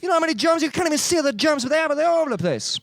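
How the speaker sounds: high, hammed-up Italian scolding; mock Italian accent. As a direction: rant in a cartoon Italian accent. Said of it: "Just in Italian".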